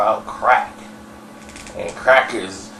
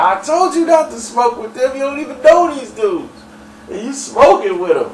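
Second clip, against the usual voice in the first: raised, high-pitched scolding; exasperated I-told-you-so, pitched up; mock scolding; 10 semitones higher than usual. Sam ribbing his friend for smoking with strangers, mock scolding.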